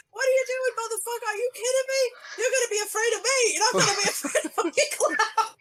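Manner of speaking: loud, high scolding mom voice; mock angry mom. Mom yelling at her kid over the drain. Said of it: "your mom would be like".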